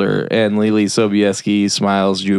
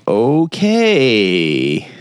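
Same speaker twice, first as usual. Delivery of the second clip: long drawn-out, wary okay; reluctant acceptance. Retold or imitated. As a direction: stretch it out, uneasily giving in. Retold man reluctantly backs off.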